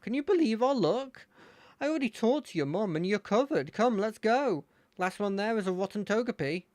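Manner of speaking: bubbly rival calling to his friend; nice-ish friend voice. Acted. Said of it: "so I'm gonna give him a nice-ish voice".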